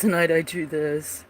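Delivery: ordinary talk, nothing marked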